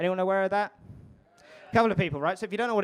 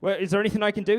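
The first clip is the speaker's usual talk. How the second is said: higher, louder mock panic; urgent, raised-pitch concern; acted alarm; 5 semitones higher, 5 dB louder than usual. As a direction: check on a friend in comic alarm. Acted alarmed man checking on friend.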